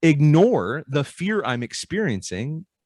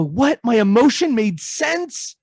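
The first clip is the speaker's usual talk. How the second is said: higher, disbelieving question; surprised client; 8 semitones higher, 3 dB louder, slower than usual. Retold or imitated. Client realizing the emotion made sense, mock surprised rise. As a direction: catch them off guard with the idea.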